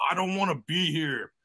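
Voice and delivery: slow, soft, unenthused aside; mock reluctance. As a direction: drag it out like you want to leave. His own glum contrast to them.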